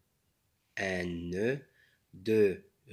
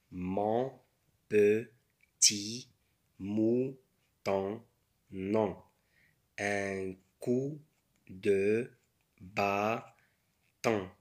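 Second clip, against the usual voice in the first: slow, word by word with pauses; syllable by syllable. Shown as a demonstration. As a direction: sound out each word for beginners. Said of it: "بالمقاطع".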